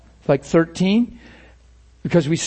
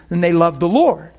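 ordinary talk, nothing marked

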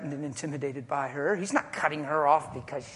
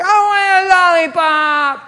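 put-on high, loud, slow child's demand; a child demanding candy, loud whiny wail; 12 semitones higher, 15 dB louder, slower than usual. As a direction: whine like a spoiled kid. Child whining in store skit.

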